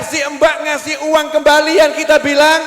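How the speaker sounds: ordinary delivery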